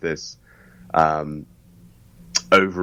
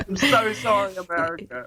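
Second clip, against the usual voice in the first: laughing apology; 12 semitones higher than usual. Real, not acted. Apologising for bad American accent.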